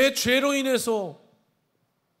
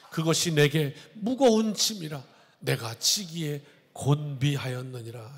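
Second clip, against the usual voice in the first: formal, measured Bible reading voice; solemn scripture reading; 5 semitones lower than usual. Acted. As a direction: read it out like holy writ from the pulpit. Reads scripture aloud.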